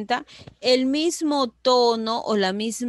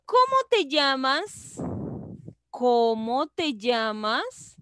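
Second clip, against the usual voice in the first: pregunta marcada, LLAMAS sube al final; 3 semitones higher than usual. Shown as a demonstration. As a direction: articula «cómo te llamas» y levanta mucho el final. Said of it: "es una pregunta la que hago yo". (pregunta clara con final ascendente)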